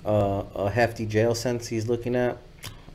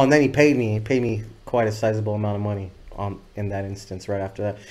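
ordinary delivery

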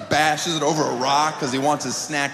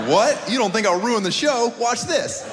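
defiant dare; 7 semitones higher than usual. Retold or imitated. Comic taking friends' bet, cocky high dare. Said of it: "I'm like".

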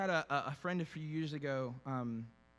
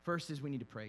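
ordinary talk, nothing marked

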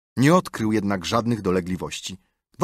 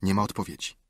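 ordinary delivery

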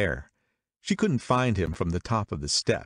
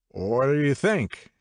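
raised, louder, pressing question; a drunk man pouncing on newcomers with a question; impetuous demand; 6 semitones higher, 3 dB louder, slower than usual. A narrator's reading. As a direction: demand it eagerly, leaning in. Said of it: "he demanded impetuously".

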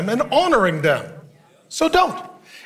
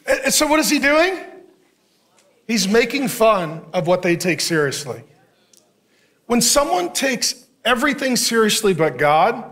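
ordinary delivery

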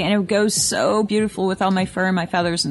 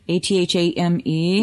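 ordinary talk, nothing marked

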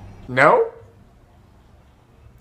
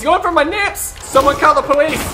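high-pitched cry for help; mock panic; 12 semitones higher, 5 dB louder than usual. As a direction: call out in hammed-up alarm. Mock panic at game attacker.

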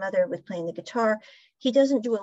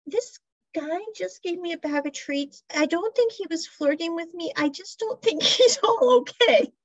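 higher, rising into laughter; laughing retelling; 7 semitones higher, 4 dB louder than usual. Retold or imitated. Woman telling her partner on the phone, laughing. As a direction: gossip to your partner, losing it. Woman phoning about strange guy.

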